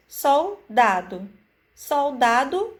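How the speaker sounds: Soldado said slowly, syllables apart; slow sounding out. Shown as a demonstration. Teacher to children, slow and clear. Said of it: "enfatizando cada sílaba".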